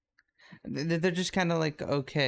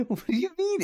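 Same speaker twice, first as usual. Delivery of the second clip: surprised. Real, not acted. Baffled by corn muffin question.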